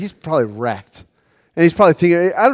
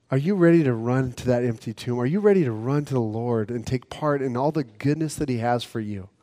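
ordinary delivery

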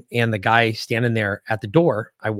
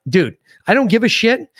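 raised, higher heated retort; 7 semitones higher than usual. Retold or imitated. Retelling arguing with the guard, raised heated voice. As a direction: tells the sergeant off, voice up high.

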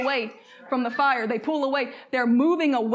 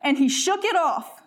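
scripture reading; 4 semitones higher than usual. Acted. Reads scripture aloud.

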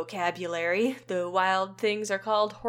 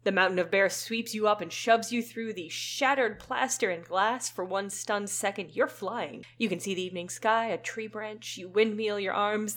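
plain reading voice

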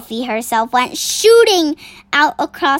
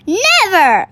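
raised, louder exclamation; passionate cry; 6 semitones higher, 3 dB louder than usual. A narrator's reading. The BFG refusing the Bloodbottler, passionate cry. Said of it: "cried the B F G passionately".

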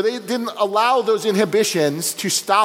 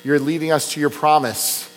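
lower, earnest prayer voice; closing prayer; 5 semitones lower than usual. Acted. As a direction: pastor praying to God to close the sermon. Pastor leads closing prayer.

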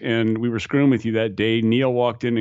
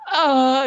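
high, loud wail; imitated panicked shriek; 6 dB louder than usual. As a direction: mimic the scream starting up. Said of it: "it would go again".

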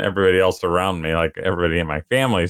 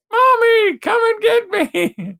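tiny squeaky high squirrel voice, much higher and louder; baby squirrel; 4 dB louder, slower than usual. Pretend. Dad voicing squirrels stuck in the porch ceiling, squeaky cry. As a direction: play a trapped baby squirrel calling for mommy. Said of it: "maybe they're just".

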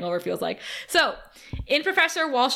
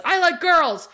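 higher, louder dumb boast; caveman declaration; 7 semitones higher, 4 dB louder, slower than usual. Retold or imitated. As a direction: blurt it like a drunk frat bro. Beer-dumbed Chet bangs the table.

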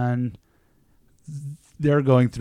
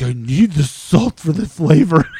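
loud, higher imitation of a stubborn friend; insistent mock protest; 4 semitones higher, 9 dB louder than usual. Man defending his salty fries, mock-insistent. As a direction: protest loudly, hammed up.

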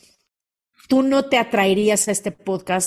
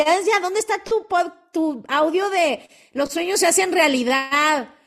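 eager fan; 8 semitones higher than usual. Retold or imitated. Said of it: "hay gente que me lo pide".

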